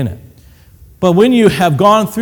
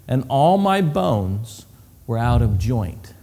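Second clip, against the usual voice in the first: slow reading; 4 semitones lower, 3 dB quieter, slower than usual. Shown as a demonstration. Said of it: "that's a poetic way to say it".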